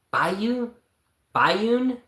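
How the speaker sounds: high, questioning tries at a name; testing each guess aloud; unsure guessing. Real, not acted. Reader unsure of the frog's name, asking himself.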